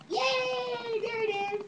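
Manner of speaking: high squeal of praise; excited cheer. Real, not acted. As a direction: celebrate the catch. Baby got the ball.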